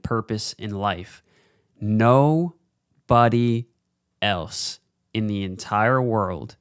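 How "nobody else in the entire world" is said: drawn-out words with pauses between; very slow, emphatic. Real, not acted. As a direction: spell it out slowly, hammering the point. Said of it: "I'm speaking so slowly into the mic".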